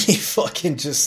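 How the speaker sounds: high, loud laugh through the words; real laughter bursting into the words. Real, not acted. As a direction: crack up while telling it, drawn out. Laughing while recalling the man's reaction.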